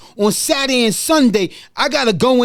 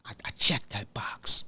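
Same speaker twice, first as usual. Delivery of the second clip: hushed whisper, barely voiced; 12 semitones lower, 12 dB quieter than usual. Real, not acted. Host to himself, checking his own box, whispered. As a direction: breathe it out like a secret to yourself. Quiet self-affirmation.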